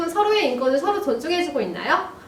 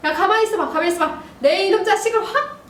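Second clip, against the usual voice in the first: higher, blustering threat; acted angry dad; 7 semitones higher than usual. Dad threatens to deal with son, acted angry bluster. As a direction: play a father about to lose his temper. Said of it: "아빠, 조금 더 화가 났어요".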